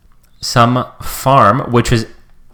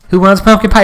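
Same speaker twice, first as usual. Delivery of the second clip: host calling out; 8 semitones higher, 6 dB louder than usual. Retold or imitated. Host offering dessert.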